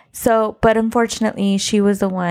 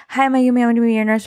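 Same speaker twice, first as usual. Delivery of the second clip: rapid-fire introduction, pitched up; fast, loud talker; 2 semitones higher, much faster than usual. Retold or imitated. New nurse introduces herself to the patient, fast and loud. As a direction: imitate someone rattling off a hurried greeting. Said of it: "talking really loud, fast".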